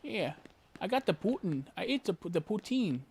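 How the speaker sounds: a hint of a bad Canadian accent; mock Canadian accent. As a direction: try a goofy Canadian accent. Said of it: "I can't do a Canadian accent right now".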